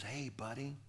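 hushed friendly call; quiet greeting. Retold or imitated. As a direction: say it softly, confidingly. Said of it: "he spoke real quiet, real soft".

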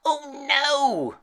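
high, drawn-out wail of horror; hammed-up high witchy cry; witch's screech. A narrator's reading. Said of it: "screeched the witch".

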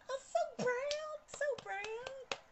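high, drawn-out squeal of pride. Real, not acted. Proud mama squeals over her viral dog. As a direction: gush over being proud, stretched out. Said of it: "I'm such a proud mama".

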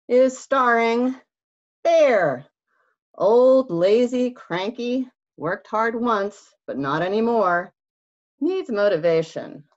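loud, high movie-trailer announcer; dramatic cast announcer. Acted. Reader announcing the book's stars, loud and dramatic. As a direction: present the characters like a big show.

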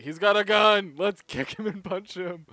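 loud, loud mock-guard shout. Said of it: "He's like".

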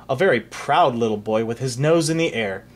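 ordinary delivery

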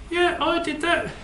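high puppet voice with a laugh; giggling puppet. Acted. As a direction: own up to the prank, giggling high. Puppet admits prank, laughing.

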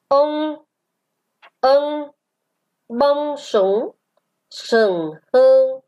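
reading model. Shown as a demonstration. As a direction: read each word out for pupils to follow. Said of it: "Chúng ta đọc trên". (đọc mẫu chậm, ngân từng tiếng)

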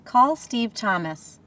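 slow, deliberate spoken command. Shown as a demonstration. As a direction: speak slowly and clearly to the car. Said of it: "All you have to remember is to say".

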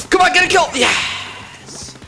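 real high-pitched gamer excitement; excited urging. Real, not acted. Urging on, then getting the kill.

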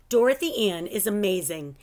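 ordinary delivery

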